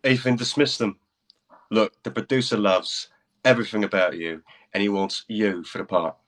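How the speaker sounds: put-on rock star persuading in a British accent. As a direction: win her over in a British accent. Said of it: "That was a fun accent for it".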